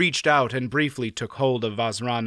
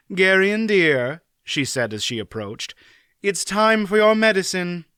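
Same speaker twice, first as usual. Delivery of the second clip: brisk aunt; 7 semitones higher, 4 dB louder than usual. Acted. Aunt Pole voice.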